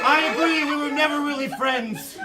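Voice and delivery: high, loud giggling reply; high loud character voice. Acted. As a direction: squeal it out. Imaginary friend hurt reply.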